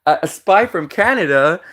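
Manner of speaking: high, scoffing question breaking into laughter; hammed-up, high-pitched incredulity; mock incredulous laughter. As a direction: imitate Americans cracking up in disbelief. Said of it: "then the Americans just start laughing".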